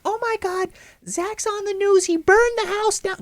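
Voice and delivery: very high-pitched imitation of a choking grandmother. Grandmother choking at news of the house fire, high mock panic. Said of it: "she just starts choking".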